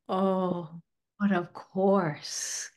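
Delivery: soft, very slow imitated loving assurance; tender reply. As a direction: play the wife, hushed and lingering. Retold spirit wife answers tenderly.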